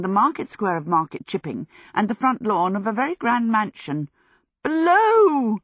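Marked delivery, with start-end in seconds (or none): 4.6-5.6 high, loud cry; cried out. Acted. Sophie zipping between places, high loud cry. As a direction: fling the word out as you fly past. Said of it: "she cried".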